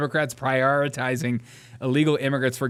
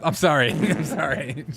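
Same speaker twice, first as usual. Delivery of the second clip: normal talking voice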